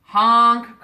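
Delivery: very loud honk; loud truck horn cry. A narrator's reading. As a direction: blare it out for help. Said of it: "cried the dump".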